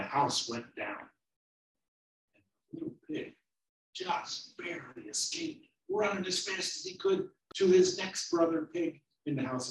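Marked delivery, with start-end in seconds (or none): none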